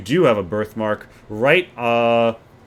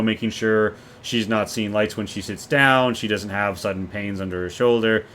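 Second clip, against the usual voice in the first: normal talking voice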